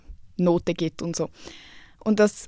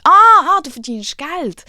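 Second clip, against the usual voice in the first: a loud, high «Ah» of mock astonishment; mock surprise; 8 semitones higher, 9 dB louder, slower than usual. Imitating the judge perking up at money, mock surprise. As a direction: act the judge's sudden interest, loud and high. Judge reacts to her income.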